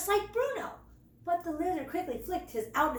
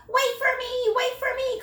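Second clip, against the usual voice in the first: much higher, louder call after them; 11 semitones higher, 5 dB louder than usual. A narrator's reading. Little cub calling after his brothers, high and loud. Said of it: "called Squeak".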